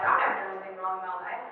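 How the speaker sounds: low, soft, smug voice put on for an imagined listener; mock self-righteous quote. Speaker to youth group, mocking a student who claims no wrongs. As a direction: play a pious know-it-all. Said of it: "I don't think any of you would think this, but, like".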